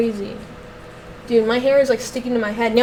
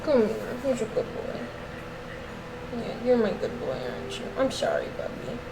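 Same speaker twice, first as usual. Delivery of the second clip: softer, tender coo to the dog; 3 semitones lower, 12 dB quieter than usual. Real, not acted. Owner apologizing to her slobbery dog, soft and doting. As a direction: make it up to him gently.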